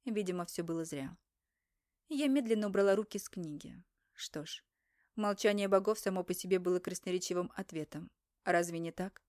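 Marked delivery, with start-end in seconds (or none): 4.1-4.6 soft resigned whisper. A narrator's reading. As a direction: breathe it out, giving up. Heroine resigned after silent gods.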